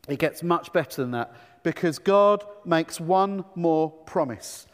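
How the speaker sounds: normal talking voice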